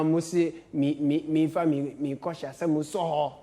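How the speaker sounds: ordinary talk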